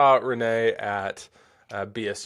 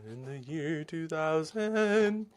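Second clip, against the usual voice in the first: slow, drawn-out, higher talk-show bit voice; Conan O'Brien impression; 5 semitones higher, 4 dB quieter, much slower than usual. Host drags out the catchphrase for his viewers in a slow mock announcer voice. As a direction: stretch it out like a late-night comedy callback. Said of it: "Is that Conan O'Brien throwback?".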